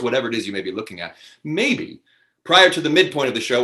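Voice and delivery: normal talking voice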